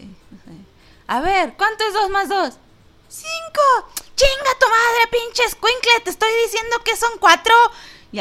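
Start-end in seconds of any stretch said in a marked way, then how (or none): 1.1-2.5 higher, louder mock teacher asking a sum; impatient teacher. Streamer acts out herself as a teacher, hammed-up questioning. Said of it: "sería algo así como". 3.1-3.6 put-on very high squeaky kid voice; a little kid guessing wrong; child answer. Mock pupil answers the teacher, very high and squeaky. 4.2-7.5 much higher, louder hammed-up screaming teacher; furious teacher. Pretend teacher screams at a pupil, over-the-top furious. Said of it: "sería algo así como".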